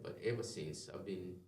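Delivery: ordinary talk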